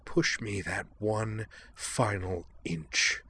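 slow, soft threatening whisper; menacing whisper. Acted. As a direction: breathe the threat right in her face. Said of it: "Amanda hissed".

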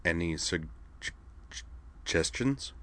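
stammered dry question, trailing off; a wry, doubtful ask with a stutter; sardonic. A narrator's reading. Kate asking Saionji for advice, sardonic and stammering. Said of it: "Then she asked sardonically".

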